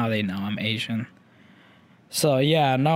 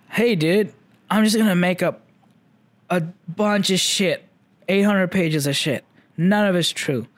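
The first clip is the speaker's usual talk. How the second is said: lightly acted dude pitching an idea, higher voice; raised, emphatic mock boast; mock enthusiastic pitch; 5 semitones higher than usual. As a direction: play at excitedly selling a wild plan. Voicing a writer's cheeky pitch.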